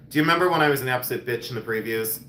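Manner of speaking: normal talking voice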